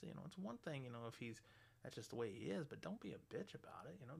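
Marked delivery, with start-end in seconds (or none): none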